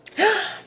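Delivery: high sharp gasp of delight; excited gasp. Acted. Storyteller gasping at Gerald's leap, high and excited. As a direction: gasp as if seeing something amazing. Reader reacts to surprise.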